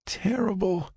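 high, soft, feeble answer; weak, sick patient. Acted. Revived patient answers Frank, high feeble voice. Sick Maria says how she feels.

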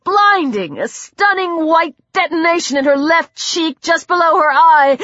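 usual speaking voice

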